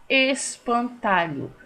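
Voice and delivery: ESPANTALHO slowly in four beats, es-pan-ta-lho; syllable by syllable. Shown as a demonstration. Teacher shows pupils a polissílaba, espantalho syllable by syllable. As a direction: pull espantalho apart piece by piece. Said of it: "Polissílaba".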